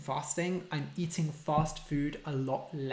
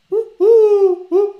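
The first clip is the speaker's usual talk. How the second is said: very high, loud woo calls with a laugh; pigeon coo; 17 dB louder than usual. Shown as a demonstration. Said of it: "that's a wood pigeon".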